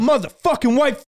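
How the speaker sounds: imitated high-pitched aggressive outburst; screaming freak-out. Homeless man cursing the one who refused him money, screaming freak-out. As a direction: mimic him losing it, voice shooting up. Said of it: "starts screaming, freaking out".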